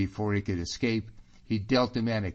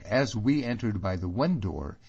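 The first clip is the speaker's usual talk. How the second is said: plain reading voice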